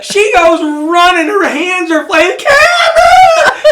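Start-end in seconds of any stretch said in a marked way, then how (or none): 2.4-3.6 very high, drawn-out wailing call; imitated scream. Mother running to her son at boot camp, mock scream. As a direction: mimic an overexcited mother screaming a name. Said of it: "She's screaming".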